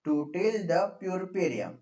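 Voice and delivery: "to till the purpurium" drawn out slowly; slow dictation. Real, not acted. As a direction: dictate it at writing speed. Dictating while writing it out.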